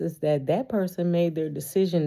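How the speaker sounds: ordinary delivery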